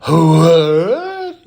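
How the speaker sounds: a hint of shocked Naomi; mock confused Naomi. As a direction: play Naomi caught off guard. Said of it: "Naomi to be like".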